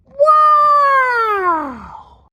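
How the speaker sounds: high, loud delighted yelp; shrieking wow of delight; excited wow. Real, not acted. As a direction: whoop at the stream. Golden stream of fuel pouring.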